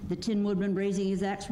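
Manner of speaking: plain reading voice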